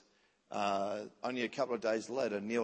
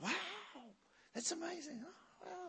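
soft, high-pitched wonder; mock-amazed soft gasp of wonder; acted hushed awe; 13 dB quieter than usual. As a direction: marvel quietly, voice lifted high. Acts out awe at God speaking.

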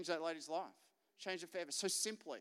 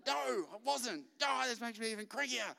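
mock cranky daughter; 7 semitones higher than usual. Daughter Jess protesting in story.

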